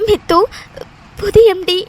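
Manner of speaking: loud, broken tearful words; acted crying. Crying friend trying to name the new MD, tearfully. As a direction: choke out words through sobs. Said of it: "நீ எதுக்கு அலறன்னு கூட".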